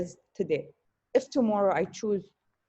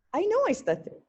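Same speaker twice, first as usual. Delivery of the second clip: I know I stutter with KNOW leaping way up high; emphatic declaration; 12 semitones higher than usual. Real, not acted. [name] telling critics she owns her stutter, emphatic high stress on "know". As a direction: land "know" high and firm, owning it.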